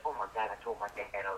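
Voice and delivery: ordinary talk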